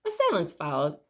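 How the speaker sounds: ordinary delivery, nothing marked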